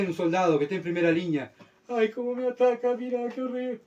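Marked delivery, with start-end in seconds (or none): 1.9-3.8 high whiny mock complaint; llanto burlesco. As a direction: play a crybaby soldier whining under attack. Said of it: "No es llorar". (soldado llorón, voz aguda fingida)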